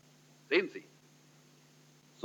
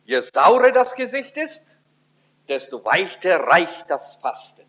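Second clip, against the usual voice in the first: ordinary delivery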